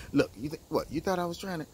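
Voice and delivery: normal talking voice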